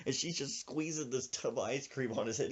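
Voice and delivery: ordinary delivery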